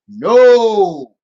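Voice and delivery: loud, drawn-out refusal; emphatic denial. Real, not acted. Gamer rejecting the Link comparison, loud emphatic denial. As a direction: stretch it out as a firm rebuttal.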